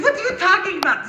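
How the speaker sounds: high-pitched incredulous question; acted disbelief. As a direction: question them like they're being silly. David scoffs at scared troops.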